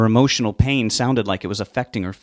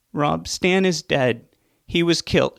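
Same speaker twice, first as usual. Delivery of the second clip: higher, slow, grief-stricken cry; crying out in pain; 7 semitones higher, slower than usual. Acted. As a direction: break the news through pain. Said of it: "she cried out in pain".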